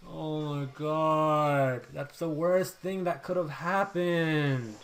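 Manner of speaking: slow, drawn-out lament; dismayed wail. Real, not acted. Gamer facing the worst possible turn, drawn-out dismay. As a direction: stretch every word in despair.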